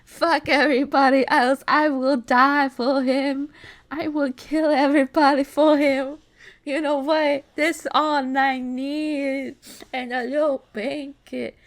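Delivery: loud, emphatic adoration, slowing at the end; fervent gushing. Real, not acted. Reader to the favorite character, loud gushing. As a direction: declare undying devotion, stretching key words. Reader gushes over the pig.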